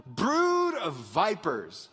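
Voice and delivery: very slow, drawn-out denunciation; slow, emphatic name-calling; strong rebuke. Acted. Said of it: "super strong, strong language".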